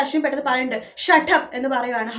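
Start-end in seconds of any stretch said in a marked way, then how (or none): 1.1-1.4 angry shout. Retold or imitated. Ashwin snaps at Shruti.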